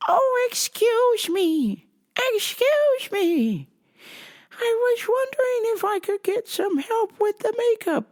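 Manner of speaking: put-on high, slow little-old-lady voice; sweet old grandma. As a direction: play a tiny sweet grandma asking politely. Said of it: "this lady was like".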